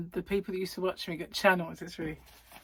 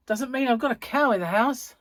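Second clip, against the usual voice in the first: loud punchline through a laugh; 5 semitones higher, 7 dB louder than usual. Retold or imitated. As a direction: laugh while landing it. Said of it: "I said".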